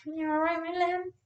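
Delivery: high, soft cooing. Real, not acted. Comforting her baby.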